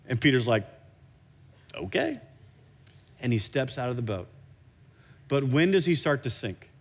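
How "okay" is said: hesitant agreement. Acted. Peter timidly answers Jesus' call.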